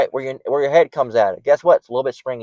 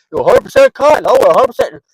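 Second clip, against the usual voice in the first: much higher, louder mock voice; mocking a smug cotton wearer; 9 semitones higher, 5 dB louder than usual. Preacher mocking someone bragging about cotton underwear, higher louder voice. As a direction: play a smug person boasting about cotton.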